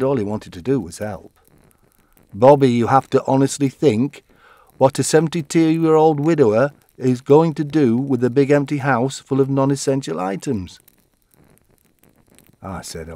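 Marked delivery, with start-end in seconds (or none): 2.3-10.8 loud, hectoring big-brother voice; lecturing brother. Retold or imitated. Brother pressing Bobby to clear the house, loud and hectoring. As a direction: talk down to him firmly.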